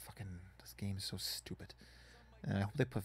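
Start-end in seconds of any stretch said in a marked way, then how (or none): 0.1-1.7 frustrated whisper. Real, not acted. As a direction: mutter it under the breath.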